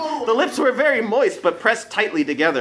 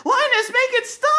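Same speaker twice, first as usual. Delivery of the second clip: much higher, shrieking for help; a girl begging her friend to end it; high panicked cry; terrified plea. Acted. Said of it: "called Lucy".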